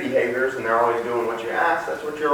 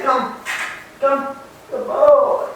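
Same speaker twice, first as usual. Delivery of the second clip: much higher sing-song call; calling the dog; 11 semitones higher, much slower than usual. Real, not acted. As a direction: call the dog in high and light.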